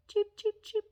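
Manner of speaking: high, soft imitated bird cheeps; bird chirps.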